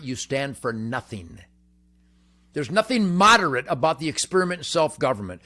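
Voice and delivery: ordinary delivery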